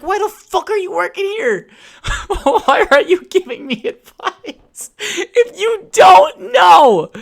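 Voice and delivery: very high, slow, shrieking yell with a laugh; hammed-up outraged shriek; retold screaming rant. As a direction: scream it half laughing, half crying. Retold blowup at clerk.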